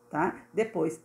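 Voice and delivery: ordinary talk, nothing marked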